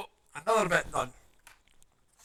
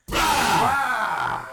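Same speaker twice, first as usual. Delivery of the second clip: loud, high roar; sudden roar; 9 semitones higher, 8 dB louder than usual. Pretend. Jumping out to scare someone, loud roar. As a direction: roar out to give a fright.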